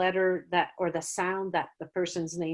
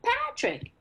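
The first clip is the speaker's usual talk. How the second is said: put-on much higher, softer kid answer; confident student; 4 dB quieter than usual. A student answering the clue in a much higher, softer kid voice. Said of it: "they're like".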